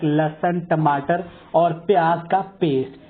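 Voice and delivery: normal talking voice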